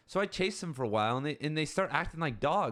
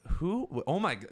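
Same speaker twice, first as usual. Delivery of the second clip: flustered; 5 semitones higher than usual. Retold or imitated. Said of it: "you'd be, like, really flustered".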